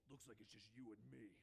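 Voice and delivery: low, grim vigilante voice; understated low menace; Batman. A narrator's reading. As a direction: state it coolly to a cornered foe.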